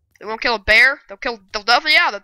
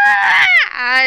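sudden high scream of defeat; short high yelp; pained shriek; 4 dB louder than usual. Real, not acted. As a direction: cry out as you die. Killed in game.

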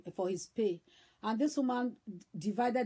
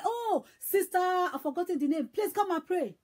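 high, raised-voice call for a sister; mock shout, pitched up, summoning someone; retold calling out; 6 semitones higher than usual. Man summons a sister to pray, calling out. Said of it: "the man just shouted".

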